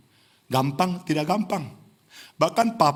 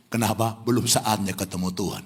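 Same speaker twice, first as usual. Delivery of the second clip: ordinary delivery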